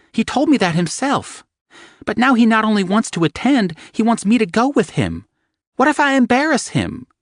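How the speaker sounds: high anxious babble; panicked rambling. Acted. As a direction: gush out her worries in a rush. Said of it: "she said in panic".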